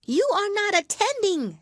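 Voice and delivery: high, loud, stern squeak; severe mouse. A narrator's reading. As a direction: scold like a tiny strict teacher. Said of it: "said the mouse to Alice severely".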